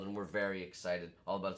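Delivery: normal talking voice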